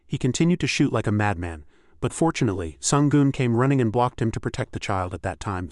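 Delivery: plain reading voice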